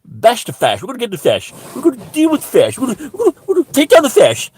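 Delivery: high, loud mock posh accent, climbing; hammed-up posh zealot, building to a squeal. Comedian mimicking posh anti-fascists, frantic mock posh accent. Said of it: "when those types go".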